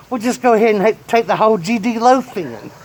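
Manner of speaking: high, loud exasperated yell; grandpa exasperated yell. Retold or imitated. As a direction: throw it at the kid in disgust. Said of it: "My grandfather goes".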